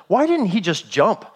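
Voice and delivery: usual speaking voice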